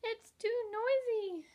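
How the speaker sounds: high, slow whiny bug voice; the ladybug grumbling about the roaring tiger, high and whiny; squeaky ladybug. A narrator's reading. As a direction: drag out the complaint in a tiny voice. Ladybug character voice.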